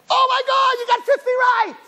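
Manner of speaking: very high, hammed-up delight; overjoyed mother. Imagined mother celebrating fifty right answers, hammed-up delight. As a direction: shriek with exaggerated joy.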